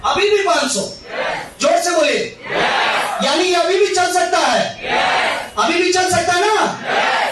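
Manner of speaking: shouting to rally the crowd; rousing shout. Real, not acted. (भीड़ को जोश में ललकारना)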